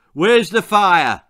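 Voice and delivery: loud, slow, booming demand; shouting. A narrator's reading. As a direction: bellow it like a fire chief. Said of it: "shouted their chief".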